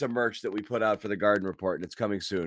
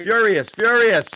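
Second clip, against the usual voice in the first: slow, loud, higher furious repeat; 8 semitones higher, 10 dB louder than usual. Real, not acted. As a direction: let the fury out slowly. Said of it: "I'm just mad".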